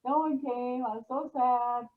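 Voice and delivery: high, loud mock sulk about the no-show; mocking her own sad porch vigil, pitched high and loud; mock whiny complaint. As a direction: whine it like a let-down child waiting on the porch. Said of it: "I called Tom and I was like".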